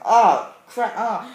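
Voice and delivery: loud, high cry of sympathy; re-enacted dismay. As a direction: wince out loud as the hero takes a hit. Reliving sympathy for beaten Batman.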